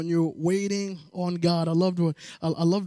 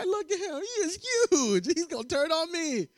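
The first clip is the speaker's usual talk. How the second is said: higher, panicky protest; higher comic panic at the huge man; mock panic; 10 semitones higher than usual. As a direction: plead in comic alarm.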